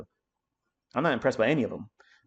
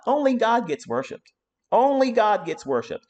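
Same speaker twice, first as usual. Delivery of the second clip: higher, louder, fervent; fierce declaration; 8 semitones higher, 4 dB louder than usual. Real, not acted. Telling followers who to worship, fiercely. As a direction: proclaim it with conviction.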